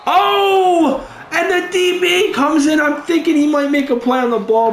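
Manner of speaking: high, jolting burst; startled exclamation. Real, not acted. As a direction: gasp at the interception threat. Sudden defensive play.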